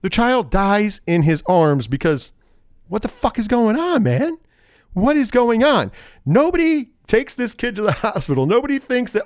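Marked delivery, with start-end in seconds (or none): 2.9-8.5 high, exasperated disbelief; what is going on, pitched up; incredulous. Real, not acted.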